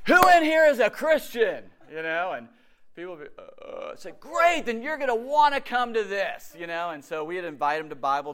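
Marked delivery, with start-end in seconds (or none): 0.1-1.6 loud, high call to a crowd. Retold or imitated. Calling out to bus riders. 4.2-6.6 loud, high-pitched rallying invitation; eager pitch. Retold or imitated. Rallying the bus riders.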